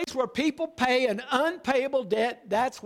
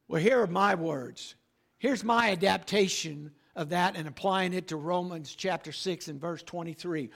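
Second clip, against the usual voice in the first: ordinary talk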